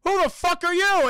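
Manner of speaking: high, very loud mock outburst; indignant shout. Santa confronting the unknown little visitor, shouting. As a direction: explode in comic affront.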